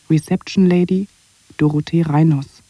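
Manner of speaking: usual speaking voice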